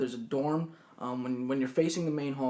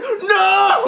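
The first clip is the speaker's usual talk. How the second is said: very high, loud, horrified cry; mock scream; 14 dB louder than usual. Girl panicking over his open boxers, high mock scream. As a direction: scream a hammed-up refusal. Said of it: "Like, she just screamed".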